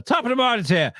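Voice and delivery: high, jaunty Irish caricature; hammed-up Irish lilt, pitched high; mock Irish accent. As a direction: put on a stock Irish greeting. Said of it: "to make an Irish accent".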